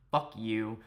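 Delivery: ordinary talk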